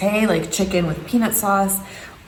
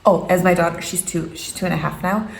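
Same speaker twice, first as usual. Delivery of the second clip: normal talking voice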